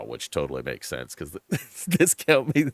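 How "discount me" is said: usual speaking voice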